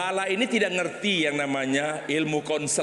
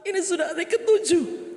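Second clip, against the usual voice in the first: high, loud begging voice; high pleading woman; 13 semitones higher, 3 dB louder than usual. Acted. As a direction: plead up high like a desperate wife. Wife back to doctor. (istri memohon ke dokter dengan suara tinggi)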